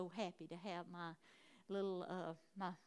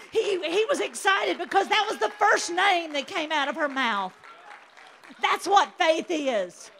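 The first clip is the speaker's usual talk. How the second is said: loud, high fired-up preaching with laughs; preaching at full force; 6 semitones higher, 15 dB louder than usual. Acted. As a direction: preach it full out, shouting to the church.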